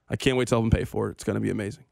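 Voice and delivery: low, quick, deadpan sarcasm; sarcastic. Real, not acted. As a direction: feign eagerness in a low, understated deadpan. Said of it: "I say that sarcastically".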